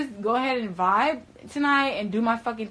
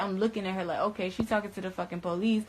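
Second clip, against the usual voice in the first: normal talking voice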